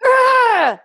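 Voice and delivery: loud high mama bear grrr; emphatic growl on request; angry bear growl. Shown as a demonstration. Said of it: "You gotta growl at me like you mean it".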